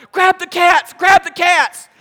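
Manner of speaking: high, loud panicked cry; panicked wife. Retold or imitated. Wife shouting to grab the cats during the smoke, panicked. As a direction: imitate the wife's frantic shout. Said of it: "And she's like".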